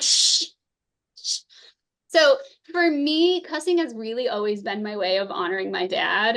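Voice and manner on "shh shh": drawn-out hushing noise; retold shushing. Mom to her cussing six-year-old, shushing. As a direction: shush a child who just cursed.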